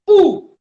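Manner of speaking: "où" said high and loud, rounded oo; French où. Shown as a demonstration. Teacher modelling French for the class, "où" high. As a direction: sing out "où" like oo.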